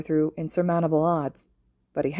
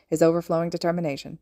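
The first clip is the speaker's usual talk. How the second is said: ordinary delivery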